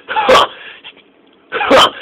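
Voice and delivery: high, scoffing "ha"; scornful grunt. A narrator's reading. Gordon jeers at Murdoch's back-engine offer, scornful grunt. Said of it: "grunted Gordon".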